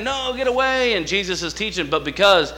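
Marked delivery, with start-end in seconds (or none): 0.0-0.9 annoyed refusal. Retold or imitated. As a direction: shout the neighbor off. Neighbor refusing again.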